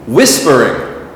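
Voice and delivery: "whispering" with WHIS stressed, falling after; stress on first syllable. Shown as a demonstration. Teacher asks learners to say with me, WHIS stressed in "whispering". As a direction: lead "whispering" with a strong WHIS. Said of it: "is in the front of the word".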